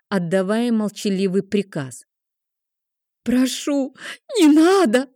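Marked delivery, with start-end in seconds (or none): 3.2-5.1 high, loud desperate scream; screaming plea. A narrator's reading. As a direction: scream for mercy. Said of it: "завопила она".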